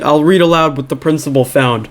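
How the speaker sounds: ordinary talk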